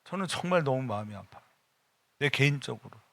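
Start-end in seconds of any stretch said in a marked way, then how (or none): none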